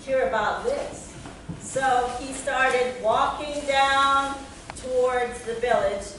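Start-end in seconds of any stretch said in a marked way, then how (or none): 0.0-1.0 lightly acted delight, pitch lifting at first; excited discovery. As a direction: marvel at it, then eager to tell. Fox discovers flute makes sound.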